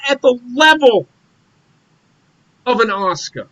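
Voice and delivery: worked-up fan venting at high pitch; heated rant. Real, not acted. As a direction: argue it hard, voice pushed way up and punching out words. Heated rant about wrestling booking.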